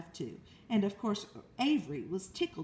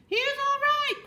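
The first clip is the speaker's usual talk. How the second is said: high, loud anxious cry; cried out; 8 dB louder than usual. Acted. Farmer reassuring the announcer, high loud cry. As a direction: call out over the crowd in alarm. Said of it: "cried Mister Zuckerman".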